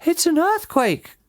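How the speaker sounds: very high-pitched outcry; alarmed cry. A narrator's reading. Missus Fox hears the digging overhead, crying out. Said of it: "cried Missus Fox".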